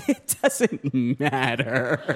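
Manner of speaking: low, soft, slow shrug of a line; playing it cool, slow and hushed; mock nonchalance. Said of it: "I wanna be like".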